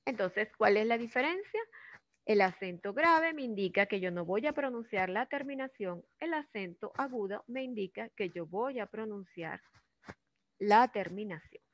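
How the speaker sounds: ordinary delivery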